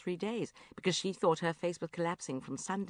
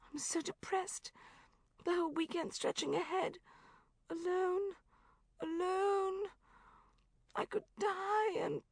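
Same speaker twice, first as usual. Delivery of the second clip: high but softer, deflated moan; softer despairing lament; 13 semitones higher, 3 dB quieter than usual. Acted. Friend repeating her misery on the phone, high but softer. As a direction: sink into it, quieter and limp.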